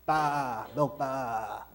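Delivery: "Bar, milk bar" with a long open "baa" like a sheep, no r; Aussie "bar". Shown as a demonstration. Teaching the class to say "milk bar" like a sheep's baa. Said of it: "pronounced bar like a sheep".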